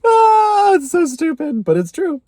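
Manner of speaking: high, loud, choked-up cry; emotional outburst. Real, not acted. Overwhelmed telling viewers she trusts herself, high choked cry. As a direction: burst out, overwhelmed with feeling.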